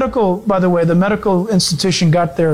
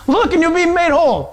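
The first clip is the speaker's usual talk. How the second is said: mocking echo; 8 semitones higher than usual. Scoffer echoes the promise in disbelief.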